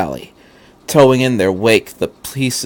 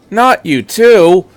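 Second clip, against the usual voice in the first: high loud slow cry; despairing shout; 8 semitones higher, 6 dB louder, slower than usual. Acted. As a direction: cry it out in disbelief. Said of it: "Misato shouted".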